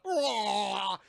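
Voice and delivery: loud mock creature bellow; imitated monster roar. Imitating Rita as she starts to melt, mock roar.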